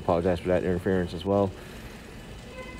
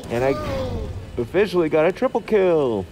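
high, loud triumphant cheer; excited; 10 semitones higher, 4 dB louder than usual. Real, not acted. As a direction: celebrate the kill. Triumphant kill in the game.

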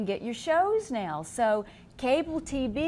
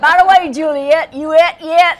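exaggerated Southern twang; 6 semitones higher, 17 dB louder than usual. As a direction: belt it out in a hammed-up Southern accent, laughing. Demonstrating her country accent.

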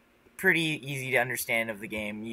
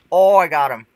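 loud triumphant cry; excited shout; 5 semitones higher, 16 dB louder than usual. Real, not acted. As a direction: shout it out, thrilled at the hit. Hits an enemy in game.

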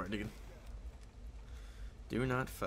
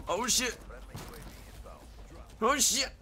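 startled yelp; 12 semitones higher than usual. Real, not acted. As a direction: shout it in sudden alarm. Sudden game danger.

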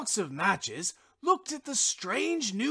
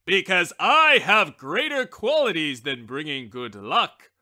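loud, lower, self-important reply; haughty wishbone; 4 semitones lower, 7 dB louder than usual. A narrator's reading. Wishbone answering the stove lifter, loud and haughty. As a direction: brush off the question with pride. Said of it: "the wishbone answered".

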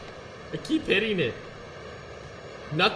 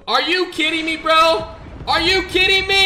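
much higher, louder exasperated shout; annoyed yell; 11 semitones higher, 7 dB louder than usual. Real, not acted. Streamer yelling at an enemy jet, exasperated shout. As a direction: bark it at the enemy player.

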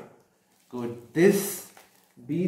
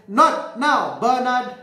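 higher, louder scolding from an annoyed mum; angry mother; 7 semitones higher, 4 dB louder than usual. A narrator's reading. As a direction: brush the child off crossly, raised voice. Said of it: "the mom gets really angry".

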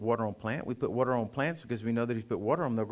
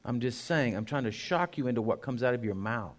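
usual speaking voice

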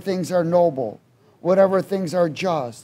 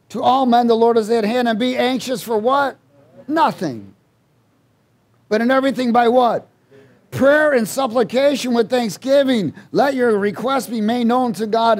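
full-force preaching; 4 semitones higher than usual. Acted. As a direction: preach it up to the rafters.

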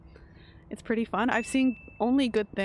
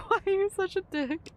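laughing; 10 semitones higher than usual. Real, not acted. Laughing retort at game character.

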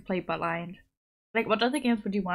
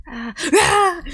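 loud, high pretend scream; sudden mock scream; 9 semitones higher, 10 dB louder than usual. Whispering player suddenly screams out of nowhere, mock scream. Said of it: "scream out of nowhere".

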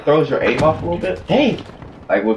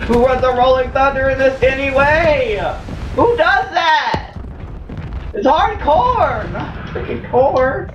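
indignant rant; 9 semitones higher than usual. Real, not acted. Ranting about enemy's gun choice.

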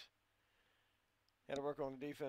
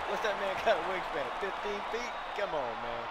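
incredulous outburst; 6 semitones higher, 9 dB louder than usual. Real, not acted. Outraged at a huge block.

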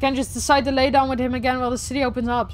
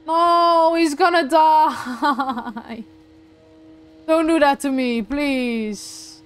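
loud drawn-out wail of "no"; distraught wail; 3 semitones higher, 3 dB louder, much slower than usual. Real, not acted. Player sees the robot friend dying, distraught wail. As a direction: cry out in dismay, stretching the last word.

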